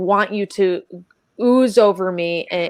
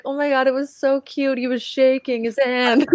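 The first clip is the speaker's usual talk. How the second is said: gushing text to Axel; 5 semitones higher than usual. Retold or imitated. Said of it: "I was like".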